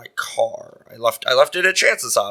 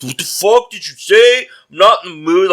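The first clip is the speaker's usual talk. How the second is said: higher, louder mock indignation; imitated outraged fans; 7 semitones higher, 6 dB louder than usual. Angry Cavs fans answering a heckler, mock outrage.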